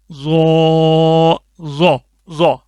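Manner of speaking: ضو said heavy and firm with a bounce; full, pressed ضو; heavy hard ضو. Shown as a demonstration. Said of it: "موٹا کر کے بھی پڑھنا ہے اور تھوڑا سختی".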